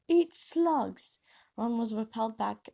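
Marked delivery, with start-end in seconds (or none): 0.1-1.2 sharp, high shout; shouted spell. Acted. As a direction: hurl it out like a curse.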